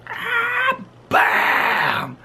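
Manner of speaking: high yelp then a bam; squealing crash yelp then bam; crash noise. Retold or imitated. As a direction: imitate the car crash. Car crash sound effect.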